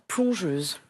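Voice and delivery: plongeuse said low with euse stressed; teacher modelling. Shown as a demonstration. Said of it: "Il fonctionne comme plongeur".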